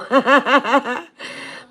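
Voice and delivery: real high laugh that rises; burst of laughter. Real, not acted. Laughs at unsayable channel name.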